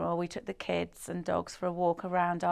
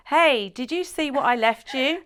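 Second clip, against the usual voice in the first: higher, louder imitation of calling to someone; 6 semitones higher, 5 dB louder than usual. Boyfriend to girlfriend in the bathroom, imitated calling out. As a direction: call it playfully as if from another room. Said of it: "he calls to her".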